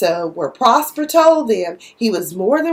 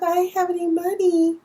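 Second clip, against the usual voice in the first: apologetic Darla; 7 semitones higher, 5 dB quieter than usual. Retold or imitated. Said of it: "She was like".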